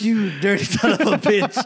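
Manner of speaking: hammed-up cursing with a laugh in it; retold outrage, relishing the insult; mock indignant. Ignored customer cursing the bartender, mock indignant.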